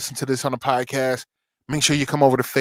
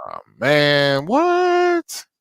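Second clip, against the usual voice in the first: exaggerated disbelief; 2 semitones higher, much slower than usual.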